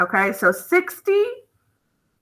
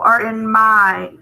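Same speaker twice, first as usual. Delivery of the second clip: corrected reading; 2 semitones lower, slower than usual. Shown as a demonstration.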